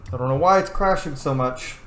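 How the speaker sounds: ordinary talk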